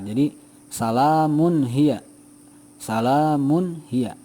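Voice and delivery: "salamun hiya" recited slowly, the n clear before h; clear izhar recitation. Shown as a demonstration. Teacher modelling the Quran reading, slow and clear. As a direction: sound the n of "salamun" plainly, slowly. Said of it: "Dibacanya seperti itu".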